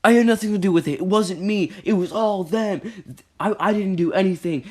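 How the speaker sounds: high, loud whiny denial; squeaky mock-whine of the kid blaming everyone else; mocking Cody. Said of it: "Cody was like".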